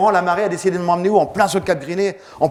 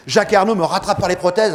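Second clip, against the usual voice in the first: ordinary delivery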